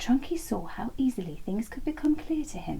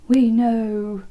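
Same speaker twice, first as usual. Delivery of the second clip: louder, groaning and fed up; exasperated groan; 11 dB louder than usual. Acted. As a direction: sigh it out in weary exasperation. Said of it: "they groaned".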